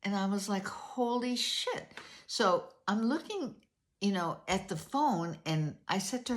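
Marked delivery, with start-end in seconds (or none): 0.7-2.0 slow, drawn-out shocked curse; stunned disbelief. Retold or imitated. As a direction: let it sink in, slow and stunned. Shocked reaction to arrest warrant.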